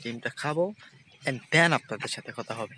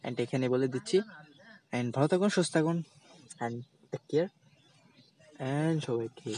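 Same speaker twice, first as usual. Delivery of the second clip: ordinary talk, nothing marked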